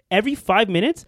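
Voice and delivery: high-pitched, exasperated griping; indignant, worked-up complaint; big mad. Real, not acted. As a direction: hammer home the grievance. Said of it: "this is how you know [name] was big mad".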